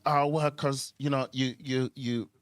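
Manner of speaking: ordinary delivery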